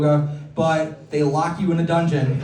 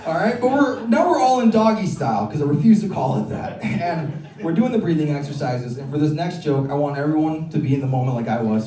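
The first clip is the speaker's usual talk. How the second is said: usual speaking voice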